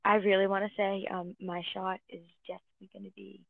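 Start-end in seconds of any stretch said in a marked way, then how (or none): 1.1-3.4 hushed whisper. Real, not acted. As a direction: whisper it under the breath. Whispered aside.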